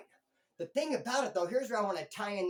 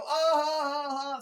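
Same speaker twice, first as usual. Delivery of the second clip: mocking laughter; 7 semitones higher, 7 dB louder, slower than usual. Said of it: "so he can go".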